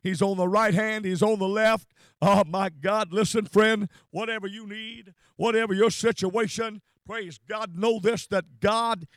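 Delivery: loud, driving sermon delivery; full-force preaching. Real, not acted. Preacher assuring friends God is around us, fervent preaching. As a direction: build in repeated phrases, proclaiming each one.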